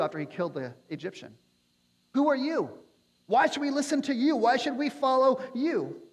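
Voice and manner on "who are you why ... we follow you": scornful challenge. Acted. Israelites challenging Moses.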